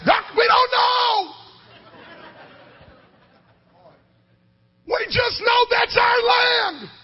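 high, loud and slow proclamation; slow preaching shout. Acted. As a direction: shout it slowly like a verdict. Pastor shouting back the answer.

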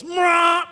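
high, loud bird screech; drawn-out parrot shriek; parrot squawk. Acted. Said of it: "and he goes".

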